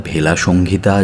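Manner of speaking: usual speaking voice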